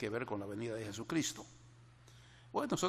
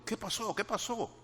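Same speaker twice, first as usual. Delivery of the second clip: worried mother; 10 semitones higher than usual. Retold or imitated. Mother asks crying girl.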